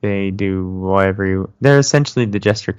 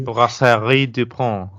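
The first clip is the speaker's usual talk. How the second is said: slow French accent on the brewery name; 3 semitones higher, slower than usual. Shown as a demonstration. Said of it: "I put on my, my best French accent".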